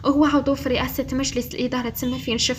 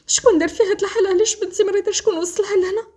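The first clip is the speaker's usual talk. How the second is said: high, louder upset mother's questions; distressed demand; 8 semitones higher, 5 dB louder than usual. A narrator's reading. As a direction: demand answers in a raised, pained voice. Tearful mother demands who hurt daughter.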